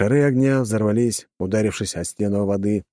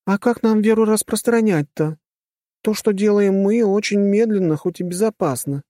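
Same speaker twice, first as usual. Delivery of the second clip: put-on high-pitched girl voice; 12 semitones higher, 3 dB louder than usual.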